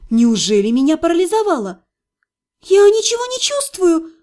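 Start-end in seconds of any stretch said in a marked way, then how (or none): 2.6-4.1 high-pitched cry of panic; panicked squeal. A narrator's reading. Patient finds she feels nothing, panicked high squeal. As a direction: let the pitch jump up in alarm. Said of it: "панически взвизгнула я".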